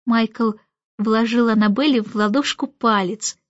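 plain reading voice

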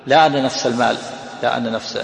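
usual speaking voice

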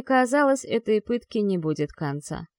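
usual speaking voice

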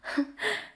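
short light giggle, half-hidden; a shy teasing little laugh; soft giggle. Acted. Young woman teasing the dreamer, soft giggle. Teasing him about not falling in love.

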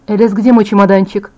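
ordinary delivery

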